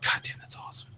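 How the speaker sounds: hushed, touched aside; barely voiced, quietly awed; a little choked up. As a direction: say it very softly, moved by the memory. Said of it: "I get a little choked up".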